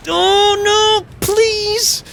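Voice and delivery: very high, dismayed whine; desperate plea. Real, not acted. Player about to lose to the boss, desperate. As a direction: plead helplessly, pitched right up.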